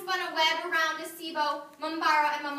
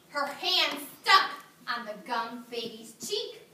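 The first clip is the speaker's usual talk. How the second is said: ordinary delivery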